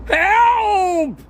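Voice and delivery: very high, loud cry for help; shouted. A narrator's reading. Douglas tripping over Jack, yelling very high and loud. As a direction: yell as you trip. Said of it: "he shouted".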